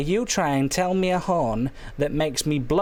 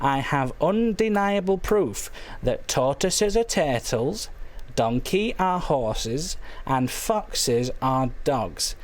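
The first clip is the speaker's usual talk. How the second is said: slow, deliberate; slower than usual. Real, not acted. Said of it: "let me tell you once again".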